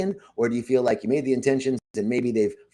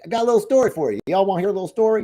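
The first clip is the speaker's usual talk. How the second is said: playful teaser; 5 semitones higher, 4 dB louder, much faster than usual. Real, not acted. Teasing the crowd with a story.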